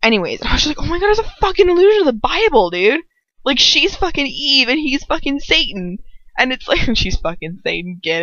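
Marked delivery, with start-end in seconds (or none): 0.9-3.0 fast, high-pitched excited rush; acted-out realization. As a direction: blurt it out like a sudden discovery. Retells her sudden realization.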